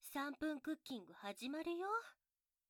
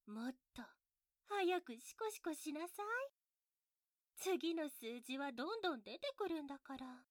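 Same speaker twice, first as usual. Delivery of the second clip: normal talking voice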